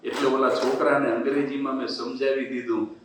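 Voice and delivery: usual speaking voice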